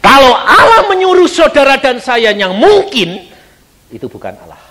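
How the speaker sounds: ordinary talk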